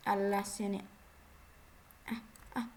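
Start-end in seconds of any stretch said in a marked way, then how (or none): none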